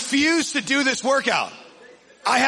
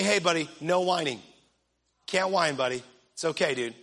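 calm, easygoing correction, pitched down; gentle parent; 5 semitones lower than usual. Retold or imitated. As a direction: talk a little one down gently. Acts himself calming his toddler son.

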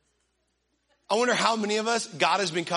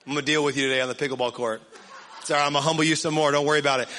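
low, fast mock taunting; teasing bravado, pitched down; trash talk; 7 semitones lower, much faster than usual. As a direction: rattle off a cocky challenge to a buddy. Acts trash-talking his buddy.

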